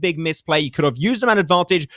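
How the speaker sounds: ordinary talk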